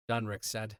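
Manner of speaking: usual speaking voice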